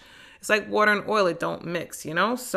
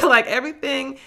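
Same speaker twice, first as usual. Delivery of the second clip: normal talking voice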